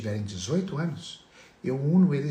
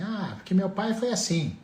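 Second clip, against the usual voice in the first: mock excuse; 8 semitones higher than usual. Voicing an absent father's excuse.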